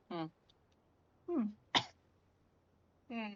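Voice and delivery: ordinary delivery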